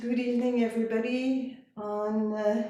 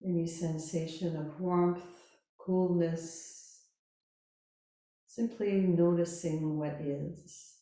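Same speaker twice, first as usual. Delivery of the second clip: slow, calm Yoga Nidra guidance with long pauses; guided meditation; 3 semitones lower, 4 dB quieter than usual. Real, not acted. Yoga teacher settling listeners lying down, calm guided meditation. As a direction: guide them gently into rest, unhurried.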